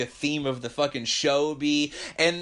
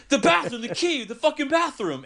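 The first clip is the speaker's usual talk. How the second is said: high, strained angry voice; frantic demand; 7 semitones higher than usual. Retold or imitated. Desperate guy yelling at the cook for the bathroom key, high and angry.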